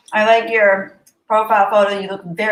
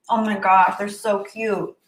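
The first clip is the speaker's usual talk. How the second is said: normal talking voice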